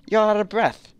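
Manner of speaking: high character voice. Acted. Voicing a game character.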